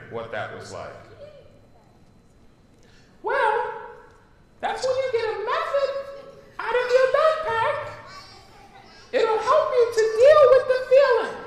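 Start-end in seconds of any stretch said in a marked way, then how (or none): 3.2-11.4 very high fairy voice with giggles. Acted. Teacher reading the fairy's lines, high and giggling. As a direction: squeak it like a giggly little fairy. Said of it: "the fairy voice".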